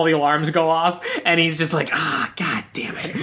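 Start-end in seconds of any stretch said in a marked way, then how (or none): 1.9-3.2 resigned mutter. Retold or imitated. Resigned quote when alarms go off.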